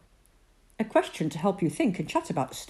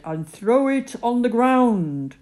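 God's voice; 6 semitones higher, 8 dB louder than usual. Acted. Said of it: "God said".

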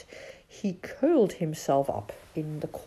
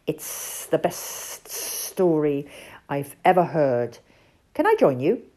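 Sid, hissing; 3 dB louder than usual. Acted. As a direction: hiss slyly like a snake asking to join. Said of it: "hissed Sid".

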